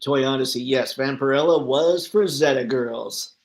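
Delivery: usual speaking voice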